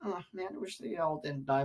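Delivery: ordinary talk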